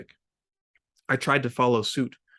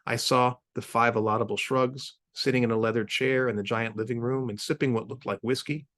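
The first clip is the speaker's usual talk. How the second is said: usual speaking voice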